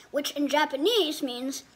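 usual speaking voice

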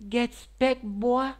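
high, loud mock scold. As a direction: scold himself in a silly voice. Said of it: "I'm like".

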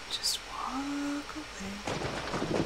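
whispered slowly, almost no voice. Real, not acted. Sneaking away from a monster, slow whisper. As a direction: breathe it out to yourself, very slow. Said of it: "whispered self-instruction".

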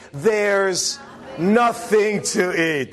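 put-on slow, drawn-out kid's complaint; drawn-out whine. Kid whining to the parent, slow drawn-out complaint. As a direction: drag out nothing like a bored kid. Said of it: "and they say".

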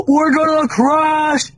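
mock panicked yell. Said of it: "I'm actually really nervous".

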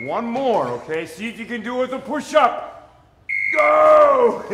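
loud, high rallying call building to a yell; coach shout. Real, not acted. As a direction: fire up the class with a big shout on the last set. Coach shouting cues to class.

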